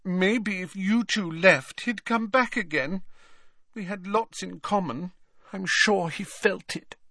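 high, wistful, plaintive voice; very high, wounded pining; plaintive ghost girl. A narrator's reading. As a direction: insist plaintively in a high girlish voice. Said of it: "she said plaintively".

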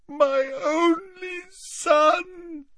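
very slow, high, crying wail.

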